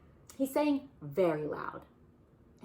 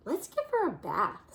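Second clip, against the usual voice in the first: Bear voice; 6 semitones higher, 6 dB louder than usual. Acted. Bear suggesting in story.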